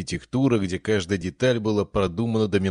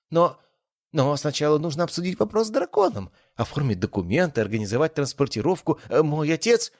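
put-on flustered young woman; 8 semitones higher than usual. A narrator's reading. Flustered girl stammers.

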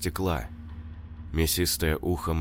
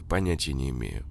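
ordinary delivery, nothing marked

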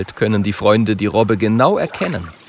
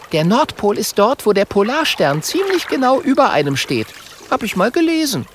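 high-pitched character voice, matter-of-fact; high little know-it-all; know-it-all Mathilda figure; 12 semitones higher than usual. A narrator's reading. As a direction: explain it in a high voice.

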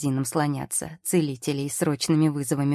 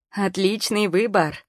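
higher, slow approval; approving; 4 semitones higher, 3 dB louder, slower than usual. A narrator's reading. Girl approving the witch's hat purchase. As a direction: praise her choice. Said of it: "одобрительно произнесла".

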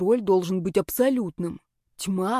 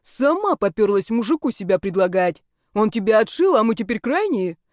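indignant scolding; 3 semitones higher, 3 dB louder than usual. Acted. Heroine rebukes the girl who blamed her, indignant scolding. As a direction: scold with mocking outrage. Heroine quarrels back, hands on hips.

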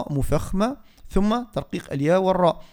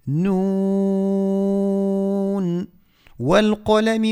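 slow, drawn-out tajwid recitation; Quran recitation; 6 semitones higher, much slower than usual. Shown as a demonstration. Teacher recites for the listeners, slow tajwid chant. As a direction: recite each word long and measured.

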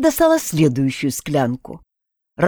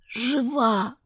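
weak, much softer rasp; hoarse croak; 4 semitones higher, 6 dB quieter than usual. A narrator's reading. Heroine answering her friend, weak soft croak. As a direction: force it out from a sore throat. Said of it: "просипела я".

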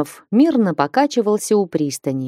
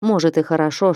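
usual speaking voice

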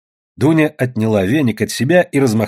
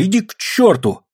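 high scolding cry; high-pitched telling-off; shouting; 8 semitones higher than usual. A narrator's reading. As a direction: send him off in a raised, high voice. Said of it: "закричала Татьяна".

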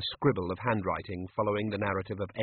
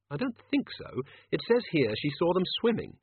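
higher-pitched character voice; puzzled reader in a raised pitch; doubtful Susan; 7 semitones higher than usual. A narrator's reading. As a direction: disagree lightly, pitched up, checking the page.

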